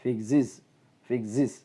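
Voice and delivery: ഫിക്സസ് said as "fik-siz"; IZ ending. Shown as a demonstration. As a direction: model word given twice, ഫിക്സസ് with short i at the end. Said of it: "അങ്ങനെയാണ് പറയേണ്ടത്". (ഫിക്സസ്, "ഫിക്സിസ്" എന്ന്)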